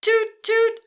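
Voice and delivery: very high, loud toot toot; tugboat toot. Shown as a demonstration. Teacher tooting like a tugboat, loud. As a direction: toot like a boat horn. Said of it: "your turn to make the sound".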